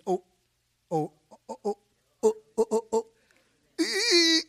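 real stammer, repeating the sound o- before it comes out; stuck on a word. Real, not acted. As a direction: keep catching on the first sound, struggling to get the word out. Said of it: "I'll get it out".

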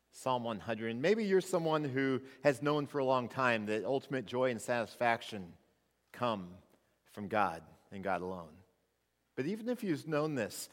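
ordinary delivery

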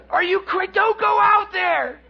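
high, loud warning holler; alarmed shouting. Acted. Fishermen warning Peter from shore, shouting in disbelief. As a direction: break off and yell the warning. Acts buddies yelling from shore.

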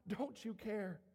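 ordinary talk, nothing marked

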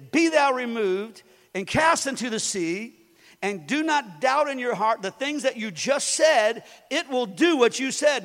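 building to a loud proclaim; preaching Jesus' words. Acted. Pastor quoting Jesus to the congregation, building loud. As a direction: quote Jesus with rising fire.

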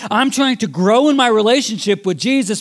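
rising sermon appeal; impassioned preaching. Real, not acted. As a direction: preach it out to the congregation.

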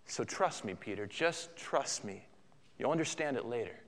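soft, low, gentle reassurance; gracious Jesus. Acted. Jesus asking Peter to trust him, gracious and soft. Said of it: "Jesus is very gracious here".

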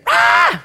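high mock shriek of fright; mock scream. Disciples seeing risen Jesus, a hammed-up scream.

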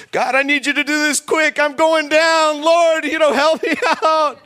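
high, loud, frantic begging; mock a desperate rushed plea to God; panicked prayer. Preacher mocking a frantic last-minute prayer, loud and high. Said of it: "don't show up to God like that".

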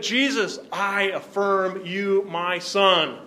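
drawn-out, solemn divine declaration; Father's affirmation. Acted. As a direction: proclaim it slowly to a son. Voices the Father affirming his Son.

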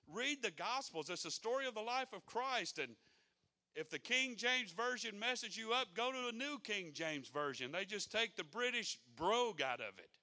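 loud, high preaching; rising sermon fire; preaching at full force. Acted. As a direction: drive it home from the pulpit.

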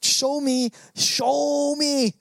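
lightly voiced scoffer, pitch climbing on the repeat; mock skeptic. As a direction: imitate a demanding skeptic daring you to prove it. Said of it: "some people who go".

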